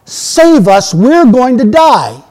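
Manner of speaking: loud, high, drawn-out cry for rescue; acted scream for help. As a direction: scream out emphatically as a panicked sailor. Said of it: "they scream out".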